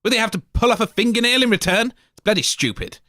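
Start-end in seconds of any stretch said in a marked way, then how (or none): none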